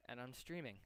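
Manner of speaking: ordinary delivery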